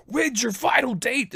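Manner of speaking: high, loud shouted question; sergeant major yell. Acted. Sergeant major questions soldier awaiting discharge, high yell. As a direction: demand it like a superior bellowing. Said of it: "yell at the knuckleheads".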